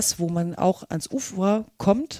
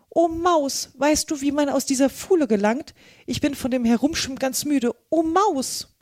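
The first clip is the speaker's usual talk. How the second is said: high, earnest appeal to a mouse; higher-voiced, tired storybook Alice; pleading Alice; 6 semitones higher than usual. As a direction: call politely in a high Alice voice.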